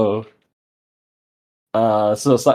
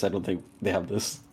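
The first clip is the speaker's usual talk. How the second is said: ordinary talk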